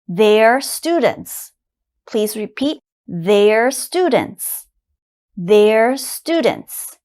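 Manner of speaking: "they're students" said very slowly; slow model for repeating. Shown as a demonstration. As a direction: stretch each word for learners to copy.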